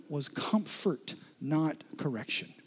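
normal talking voice

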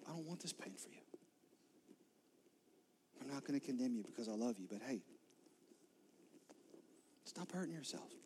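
a gentle Jesus lifting her face to comfort her; tender pleading. Retold or imitated. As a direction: plead softly with someone you love. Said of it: "He doesn't say it like".

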